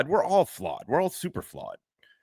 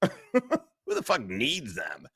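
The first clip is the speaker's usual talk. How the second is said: slow, laughing repeat of the line; 3 semitones higher, much slower than usual. Shown as a demonstration. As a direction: drag it out while laughing.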